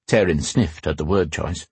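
ordinary delivery, nothing marked

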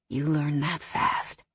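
low, slow, soft male murmur; rasped. A narrator's reading. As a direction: murmur it huskily between kisses. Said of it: "he rasped against her lips".

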